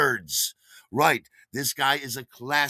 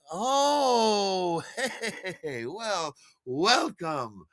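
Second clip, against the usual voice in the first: awkward laugh; 8 semitones higher, much slower than usual. Retold or imitated. Caught off guard, greeting stranger.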